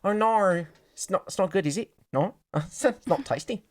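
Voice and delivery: hammed-up Australian vowels; bad Australian accent. Teasing an Australian friend, bad Australian accent. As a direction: put on a poor Australian accent. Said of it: "letting my bad Australian accent slip".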